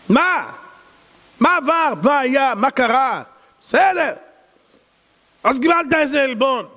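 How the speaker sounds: high, mocking what happened; mock surprise. Rabbi teasing a congregant about an insult, mock surprise. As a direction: tease the listener's drama. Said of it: "rabbi mocks fuss over insult".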